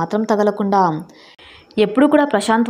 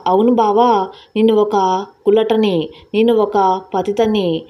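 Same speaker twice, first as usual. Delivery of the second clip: plain reading voice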